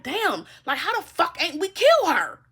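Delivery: outraged that she survived; mock enemy. Reader mimicking enemies who wanted her gone, high and loud. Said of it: "they're like".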